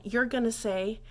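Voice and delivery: ordinary talk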